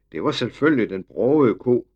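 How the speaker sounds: ordinary delivery, nothing marked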